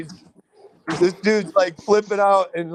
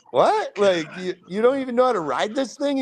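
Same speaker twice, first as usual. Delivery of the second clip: retold disbelief; 3 semitones higher than usual. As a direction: act out being baffled by a clueless buyer. Retold disbelief at clueless buyer.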